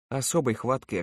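ordinary delivery, nothing marked

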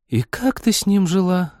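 high-pitched sigh of disbelief; sighing dismay. A narrator's reading. One girl commiserates with the other about the pilot, sighing. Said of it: "вздохнула Полина".

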